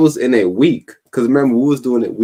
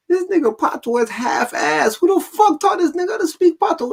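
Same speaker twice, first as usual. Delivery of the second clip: exasperated Jamaican critic; 3 dB louder than usual. Retold or imitated. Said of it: "I be like".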